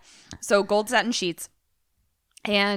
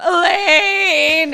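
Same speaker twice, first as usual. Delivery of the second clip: high, drawn-out wailing shout; mock scream; 10 semitones higher, 9 dB louder than usual. Man calling from the bedroom, hammed-up tearful scream. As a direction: imitate a man screaming for his lover through tears. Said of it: "Wayne is screaming from the bedroom".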